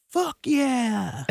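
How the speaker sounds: high, loud crowd yell. Retold or imitated. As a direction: whoop it from the crowd. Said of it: "to just yell".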